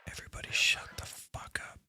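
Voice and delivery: whispered command, soft and airless; stealthy whisper. Acted. Sneaking in the game, whispering to hush everyone. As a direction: hiss it while sneaking. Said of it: "I do this voice when I'm being stealthy".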